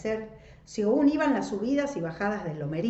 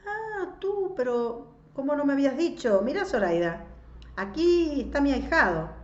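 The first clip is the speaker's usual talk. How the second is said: surprised godfather, lively and raised; delighted recognition; 9 semitones higher, 5 dB louder than usual. A narrator's reading. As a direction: greet a long-lost godson, pitch jumping up. Recognises his godson with surprise.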